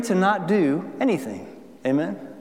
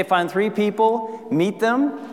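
ordinary talk

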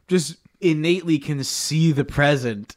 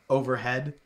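ordinary delivery

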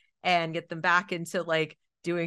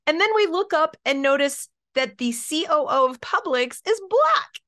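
ordinary delivery

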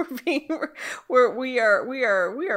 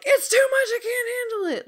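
freaking out; 12 semitones higher, faster than usual. Retold or imitated. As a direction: squeal it, overwhelmed and hammed-up. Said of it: "was, like, freaking out".

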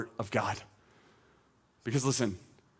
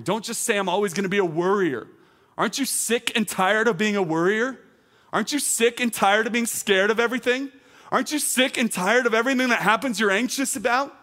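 high, loud rallying preaching; fervent exhortation; 9 semitones higher, 6 dB louder than usual. Real, not acted. Preacher urging worriers to declare war, fervent. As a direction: challenge them with rising, forceful questions.

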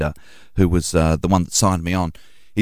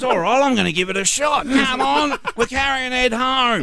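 higher, hammed-up drunk bloke; drunk mate voice. Drunk rallying his mates to carry Ed, hammed-up drunk. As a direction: cheer the mates along like a drunk. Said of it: "And he goes".

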